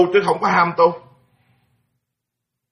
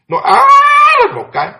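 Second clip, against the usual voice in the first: sudden high, loud bellowing cry; mocking a ritual master's bellowing chant; 7 dB louder than usual. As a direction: let out a howl like a lowing cow. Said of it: "là bò rống vậy đó".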